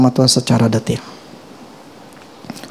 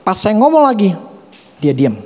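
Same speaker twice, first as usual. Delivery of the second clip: ordinary talk, nothing marked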